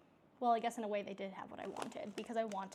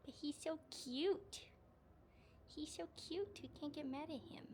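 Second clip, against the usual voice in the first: softer high doting voice about the cat; cooing over cat; 6 semitones higher, 7 dB quieter than usual. Real, not acted. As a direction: coo like talking to a pet.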